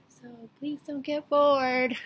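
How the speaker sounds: loud, high-pitched playful appeal; playful plea. Real, not acted. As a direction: plead jokingly with the audience, voice rising and swelling. Jokingly pleading with viewers.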